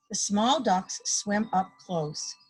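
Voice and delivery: usual speaking voice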